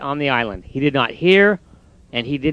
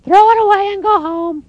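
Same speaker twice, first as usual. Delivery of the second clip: mocking robins; 3 dB louder than usual. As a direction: tease him from the bush.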